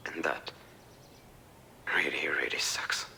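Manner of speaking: despairing whisper. Real, not acted. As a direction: let it drop to a bare whisper. Son admits despair quietly.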